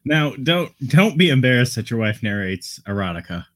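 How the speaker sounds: ordinary talk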